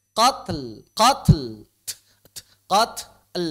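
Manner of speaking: "Qatl" with a puff of air between t and l; aspirated t sliding straight into l in "qatl"; breath on ta. Shown as a demonstration. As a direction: release the t of "qatl" with breath, no vowel. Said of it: "embusan napas".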